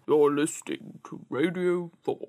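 put-on BBC continuity man; mock station ident in a man's voice; male Radio four voice. Shown as a demonstration. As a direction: imitate a male radio announcer. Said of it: "You didn't do a male Radio four voice properly".

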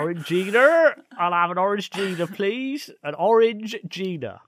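hammed-up Cornish request; Cornish accent. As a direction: order a drink in broad comic Cornish. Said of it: "say it in a Cornish accent".

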